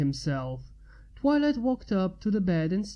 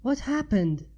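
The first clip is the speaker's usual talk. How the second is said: higher, urgent question; Twilight voice, urgent; 6 semitones higher than usual. A narrator's reading. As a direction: ask quickly, worried.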